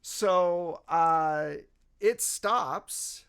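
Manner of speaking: usual speaking voice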